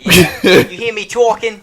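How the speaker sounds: put-on loud high Boston accent; hammy Boston tough guy. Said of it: "a Boston accent".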